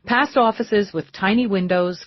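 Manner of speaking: usual speaking voice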